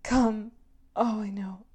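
loud, breathy urging; insistent and forceful; urgent command. Acted. Urging at the scene's peak.

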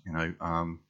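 ordinary delivery

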